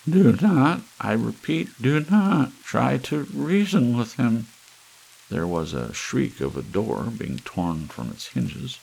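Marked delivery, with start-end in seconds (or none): 0.1-4.5 much higher and louder alarmed announcement; urgent warning. Acted. Ballerina warning viewers, high and loud. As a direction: sound the alarm. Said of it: "said the ballerina".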